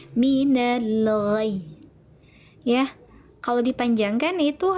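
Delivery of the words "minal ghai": "Minal ghai" drawn out very slowly, pressed on "ghai"; nabar demo. Shown as a demonstration. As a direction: recite "Minal ghai" slowly, leaning on the final "ghai" without breaking it. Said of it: "Dinaikkan intonasinya". ("Minal ghai" dengan nabar, pelan dan ditekan di "ghai")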